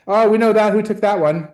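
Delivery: loud, high delighted exclamation; excited. Real, not acted. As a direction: burst out with delight at the photo. Reacting to a photo.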